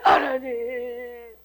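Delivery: long, droning call to God; mock penitent wail. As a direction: stretch it out on one note, hammed-up. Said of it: "그럼 또 와가지고".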